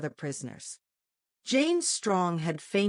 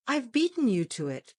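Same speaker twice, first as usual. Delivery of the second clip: a touch of triumph, pitched up; triumphant; 5 semitones higher than usual. A narrator's reading. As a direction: crow a little over the win. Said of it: "with a note of triumph in his tone".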